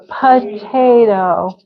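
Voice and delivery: "Potato" slowly as puh-TAY-doh; slow D version. Shown as a demonstration. Teacher has class try potato, slow and separated. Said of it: "I usually put the D".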